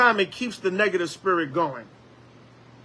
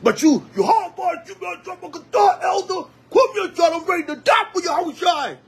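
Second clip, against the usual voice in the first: mock zealous brother; 10 semitones higher than usual. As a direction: puff up like a hard boy. Mocks showy zealous brother.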